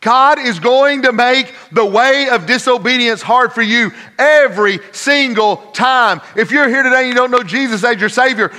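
loud, high preacher's cry; full-force preaching. Real, not acted. Preacher warning the congregation about disobedience, full-force preaching. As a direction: drive each phrase home at full voice.

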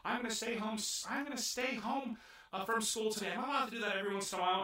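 put-on high, whiny spoiled-girl voice, fast at the end; bratty princess. Princess whining to her butlers that she stays home. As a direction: play the pampered rich girl refusing school. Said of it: "And she's like".

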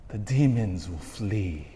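usual speaking voice

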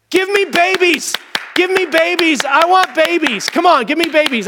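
high, pushy, hammed-up begging; mock demanding groom. Groom pestering his new wife, hammed-up high demanding. Husband demanding on wedding night.

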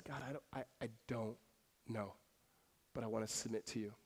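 very soft, low, halting prayer; hushed, reluctant admission with long pauses; subdued surrender. Retold or imitated. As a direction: quietly give in to God, haltingly. Retold surrender to God in prayer.

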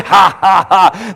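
staged, measured "ha, ha, ha"; God's mocking laugh. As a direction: laugh off the devil in separate ha's. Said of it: "He laughs. He's like".

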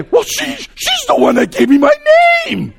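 high, loud, fed-up student voice; exasperated student. Retold or imitated. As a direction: play the student throwing up their hands. Imitating student's exasperated answer.

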